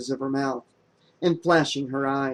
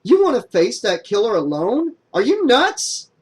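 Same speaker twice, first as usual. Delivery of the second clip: high, loud disbelief; voice up an octave; 8 semitones higher, 6 dB louder than usual. Acted. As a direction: shoot the pitch way up. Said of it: "voice went up an octave".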